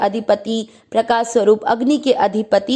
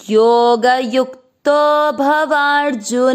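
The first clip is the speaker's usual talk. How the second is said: slow verse recitation; much slower than usual. Shown as a demonstration. Teacher reciting the Gita line slowly for students to follow. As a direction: chant each syllable of the verse slowly and evenly. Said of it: "चौथा चरण repeat".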